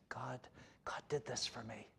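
unvoiced, hushed God did this for me; whispered thanks. Believers quietly thanking God in a whisper. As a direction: breathe it like a private prayer.

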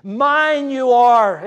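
Mine you are, slow and loud, Mine punched; emphatic Hebrew order. Shown as a demonstration. Preacher showing how the Hebrew reads, slow and emphatic. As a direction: stress the word Mine hard and slow. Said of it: "the language is so emphatic".

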